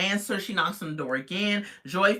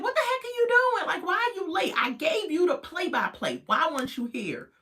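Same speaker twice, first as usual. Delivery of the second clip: high, exasperated mom scolding; 11 semitones higher than usual. Retold or imitated. Mom scolding a late Joy from the car, high and exasperated. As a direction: squeal it out like a fed-up mom. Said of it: "The mom is out the car, like".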